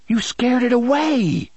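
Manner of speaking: put-on high, loud boy's accusation; boy accusing a friend of scaring the pet. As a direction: blame him, indignant. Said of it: "Jake accused".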